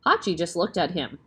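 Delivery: ordinary delivery, nothing marked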